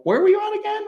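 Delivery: high squeaky mock question. Host acts out a skeptic asking the storyteller, high mock squeak. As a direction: jump way up in pitch to play the skeptic.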